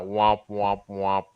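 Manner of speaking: low, fading womp womp; mock sad trombone, dropping low. Mocking a laugh-at-own-joke moment.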